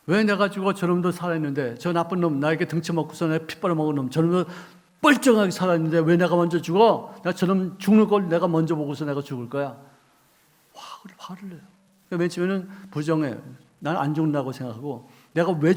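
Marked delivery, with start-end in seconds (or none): 0.1-9.8 loud, high furious protest against dying; angry outburst. Acted. Dying man shouting at the pastor in anger. 10.7-11.6 breathy whispered aside. Real, not acted. Preacher whispering to the congregation after the outburst. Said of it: "whispered comment after outburst".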